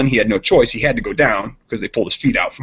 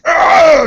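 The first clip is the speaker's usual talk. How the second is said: high loud yell of "ah" as he swings; retold battle yell; 8 dB louder than usual. Friend acting out drunk Brian's yell with burning board, high shout. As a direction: let out a wild drunken yell. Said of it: "grabs this and goes".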